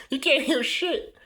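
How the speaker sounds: high, slow, laughing repeat. Real, not acted.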